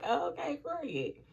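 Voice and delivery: mock-casual through giggles; laughing okay. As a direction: laugh out the "okay" in giddy disbelief. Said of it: "I was like".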